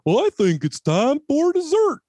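high, put-on silly voice imitating wife; wife's voice-off attempt. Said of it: "she comes in with, like, um".

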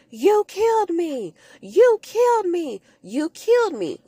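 put-on high, eerie, rising accusation from the dead girl; possessed ghost voice. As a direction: wail it like a vengeful spirit. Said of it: "when Alicia is talking".

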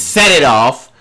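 suddenly loud, sharp call-out. Real, not acted. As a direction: blurt the title out loud. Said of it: "Why you yelling?".

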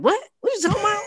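high, incredulous what are you on about; mock confused indignation. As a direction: play it baffled and a little offended. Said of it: "And I looked at him like".

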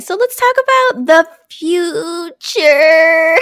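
slow, high put-on cartoon voice; SpongeBob quote. Cohost quoting SpongeBob for laughs, high slow cartoon voice. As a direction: ham up the cartoon line, slowly. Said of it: "If you don't know that reference".

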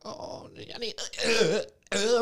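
high retching imitation; mock retching. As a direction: imitate her throwing up. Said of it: "I'm talking about, that hoe was throwing up".

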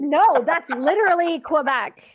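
loud, drawn-out insistence; emphatic protest. Real, not acted. Soldier rejecting the Sesame Street comparison, loud emphatic protest. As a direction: correct them emphatically, stretching the words. Emphatic correction of co-host's guess.

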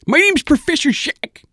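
very high, loud mad scientist voice; squeaky eccentric inventor introduction; mad professor voice. Acted. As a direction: announce yourself like a zany cartoon professor. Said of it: "the man says".

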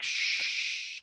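soft unvoiced shush; whispered shush. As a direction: hush it like a whisper. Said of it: "he'll take something off and be like".

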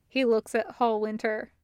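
usual speaking voice

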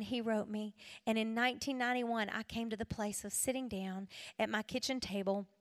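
ordinary talk

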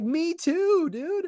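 high, thrilled "me too"; excited. Retold or imitated. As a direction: burst out with delight. Retold excited agreement.

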